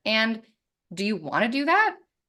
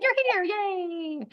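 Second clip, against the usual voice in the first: mock cheer; 13 semitones higher, much slower than usual. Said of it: "I was just like".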